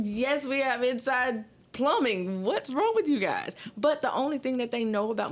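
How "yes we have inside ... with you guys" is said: exasperated retort. Retold or imitated. Mississippi woman answering outsiders about indoor plumbing, exasperated. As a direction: answer a silly question with drawn-out exasperation. Retold retort to ignorant question.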